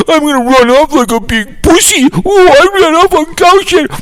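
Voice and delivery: high whiny mocking crybaby voice. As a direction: whine like a big baby running off. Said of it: "runs up like a pussy".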